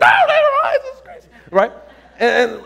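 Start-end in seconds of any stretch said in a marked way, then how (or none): 0.0-1.3 very high squeal breaking into laughter; imitated wild excitement. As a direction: freak out with delight in a squeaky voice. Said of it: "We're more excited than she is".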